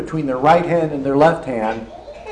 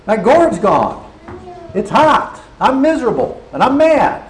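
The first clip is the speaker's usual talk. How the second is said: angry Jonah; 8 semitones higher, 3 dB louder than usual. Acted. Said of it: "And he says".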